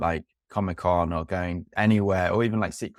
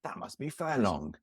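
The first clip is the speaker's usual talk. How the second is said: lightly voiced [name], quieter; [name] impression; 7 dB quieter than usual. Film chat, host quotes [name]'s line in his voice. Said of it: "That's my [name]".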